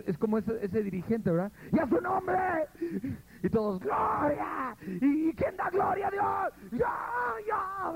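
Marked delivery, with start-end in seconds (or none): 1.7-2.4 mock worship cry. Mocking emotional worship leader. 3.4-6.7 mock crying shout. Imitating frenzied worship shouting. 6.7-7.9 mock congregation cry. Congregation answer imitated.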